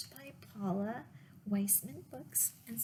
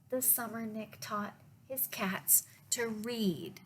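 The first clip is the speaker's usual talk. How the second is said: usual speaking voice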